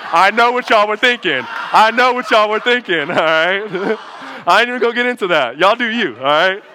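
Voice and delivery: loud, laughing through the words. Real, not acted. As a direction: laugh it off at the crowd. Joking aside to the congregation.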